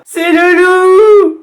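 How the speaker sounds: cri très aigu et fort, traînant; cri d'enfants. A narrator's reading. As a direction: hurler de peur, voix d'enfant. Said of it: "crient-ils". (les enfants crient de peur, cri aigu)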